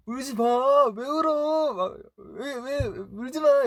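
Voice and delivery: very high-pitched, exclaiming imitation; writer's voice. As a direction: mimic a writer fussing over someone crying. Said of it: "작가님 목소리로 이제". (높은 톤으로 달래는 작가님 흉내)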